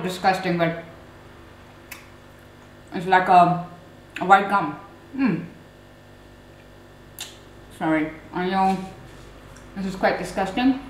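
talking around chewy wax; chewing while talking. Real, not acted. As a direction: mouth partly full. Seen 1: Closed, working lips mid-stretch; wax later pulled from mouth at 7.6.

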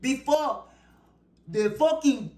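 put-on ranting in a playful African accent. Tarot reader ranting about the karmic people, in a mock African accent. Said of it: "in my African voice".

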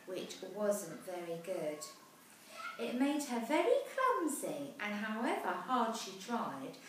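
put-on lively rhyming read-aloud for children; storybook narration. A narrator's reading. Parent reading a rhyming picture book aloud, lively. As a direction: tell the rhyme brightly to a child.

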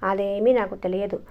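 ordinary delivery

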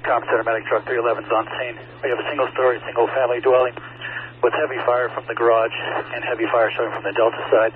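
loud, urgent radio report over noise; urgent firefighter radio. Acted. Engine officer radios arrival on scene.

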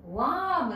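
ワーム with a long open "ah"; mispronounced warm. Shown as a demonstration. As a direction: show the mistake ワーム, rhyming with arm. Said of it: "違うんですよ、".

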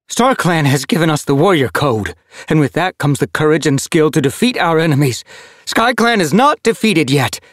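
rally the clan against despair; fierce she-cat. A narrator's reading. Ginger she-cat defends StarClan, high and defiant.

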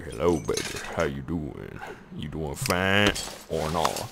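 sleepy croaky morning voice; groggy morning voice. Shown as a demonstration. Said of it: "So I kinda sound like".

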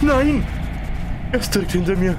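high panicked yelling; being chased. Real, not acted. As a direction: scream while fleeing the monster. (panisch gejagt)

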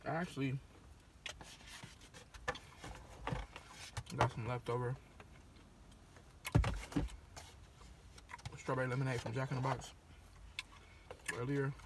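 chewing between words; talking with food in mouth; mouth full. Real, not acted. Seen 5: bulging cheeks and closed chewing mouth after eating bites.

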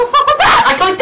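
very high, loud protest; indignant squeal; outraged yell. Real, not acted. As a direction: scream that the game cheated you. Protesting at the controls.

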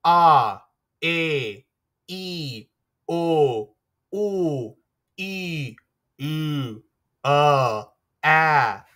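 "A, e, i, o, u" each vowel held long. Shown as a demonstration. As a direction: draw out every vowel sound. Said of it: "try pronouncing these by yourself".

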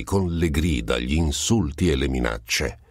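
ordinary delivery, nothing marked

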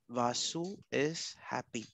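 slow, soft, low murmur to self; typing aloud. Real, not acted. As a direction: sound out the words slowly while typing. Reads aloud while typing label.

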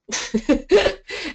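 high bright laugh at the word death; burst of laughter. Real, not acted. Advisor laughing while telling clients the topics.

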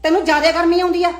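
loud, sharp scolding; angry scolding. Acted. Mother-in-law scolding daughter-in-law over her clothes, loud and angry. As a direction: tear into her about the clothes.

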